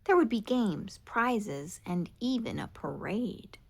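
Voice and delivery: ordinary delivery, nothing marked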